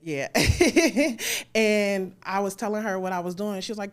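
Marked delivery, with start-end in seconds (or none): 0.3-1.5 loud high burst of laughter; real laugh. Real, not acted. Laughs at host's remark.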